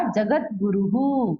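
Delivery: slow जगत गुरुहु with a drawn-out echoed "hu"; visarga as "hu". Shown as a demonstration. Teacher showing the effect of the u matra, slow "hu". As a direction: stretch out guru-hu at the end of जगत गुरुहु. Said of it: "इसलिए क्या उच्चारण हुआ?".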